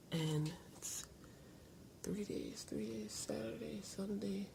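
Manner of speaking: whispering. Real, not acted. Counting days under breath.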